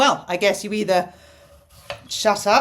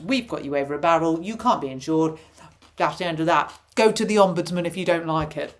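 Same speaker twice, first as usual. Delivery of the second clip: usual speaking voice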